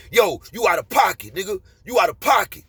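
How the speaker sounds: PO yelling. Retold or imitated. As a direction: bark it down the phone like an angry parole officer.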